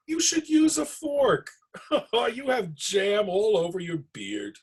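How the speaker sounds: high, laughing scold; laughing at the messy bear. Acted. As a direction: tease him through laughter. Bubba laughed.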